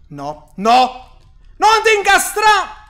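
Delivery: loud panicked pleading; frantic, drawn-out cry. Real, not acted. Panicking during chase. (panico durante l'inseguimento)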